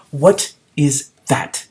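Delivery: slow, suspicious disapproval; disapproving. A narrator's reading. Lizard eyeing the kitten, disapproving. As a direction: eye the kitten with distaste. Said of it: "he asked disapprovingly".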